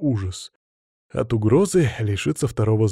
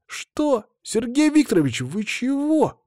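put-on high-pitched alarmed child protesting; shocked objection; 12 semitones higher, slower than usual. A narrator's reading. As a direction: blurt it out high, in dismay. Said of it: "взорвались возражениями". (испуганно и возмущённо, высоким голосом)

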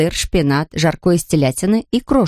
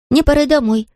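put-on hurried child voice; 8 semitones higher than usual. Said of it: "заторопилась Майя".

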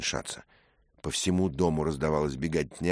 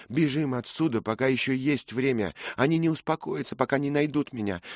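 high, urgent pleading that builds louder; panicked cry; 11 semitones higher, 4 dB louder than usual. A narrator's reading. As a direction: beg desperately to be let go. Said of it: "вскрикнул Мэтчем". (отчаянная, паническая мольба на высоких тонах)

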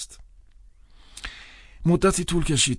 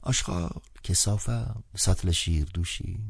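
slow disdainful list of junk; imagined old priest listing trash; dismissive listing; 5 dB quieter than usual. A narrator's reading. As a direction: say it like naming rubbish. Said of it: "همانطور که ممکن بود بگوید".

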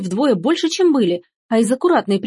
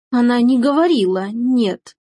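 plain reading voice

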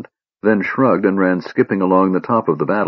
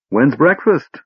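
bright, eager ask, pitched higher; a chirpy kender asking for food; cheerful question; 7 semitones higher than usual. A narrator's reading. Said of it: "inquired cheerfully".

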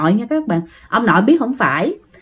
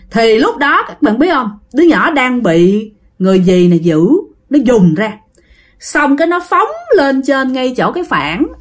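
plain reading voice

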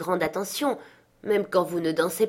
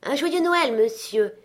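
high, lively festive greeting; cheery hail; 7 semitones higher, 4 dB louder than usual. A narrator's reading. Merry fellows greet Scrooge in the street, cheery hail. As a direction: call out merrily, starting high and loud. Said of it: "joyeux gaillards ne purent s'empêcher de l'interpeller".

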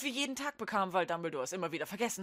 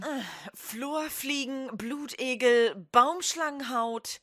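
slow and deliberate; 3 semitones higher, slower than usual. Acted. Malfoy lists ingredients slowly.

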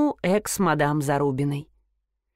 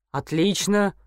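ordinary delivery, nothing marked

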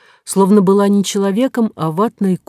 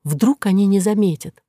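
ordinary delivery, nothing marked

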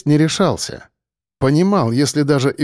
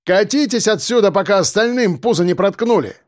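high, projected warning; threatening call; 6 semitones higher than usual. Acted. Defender driving attackers from the cave, threatening call. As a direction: warn them off from a distance.

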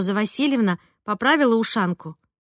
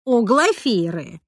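slow, weighty correction; meaningful; 3 dB louder, much slower than usual. A narrator's reading. Roza corrects her on the serial heroine's name, meaningfully. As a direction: stretch the name out significantly. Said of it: "со значением произнесла Роза Васильевна".

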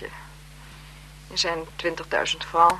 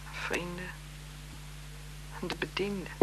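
barely voiced, much softer; fading whisper; 16 dB quieter than usual. Marquise murmuring to herself, fading whisper. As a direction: let it fade to nothing. Marquise listing who she'll be kind to.

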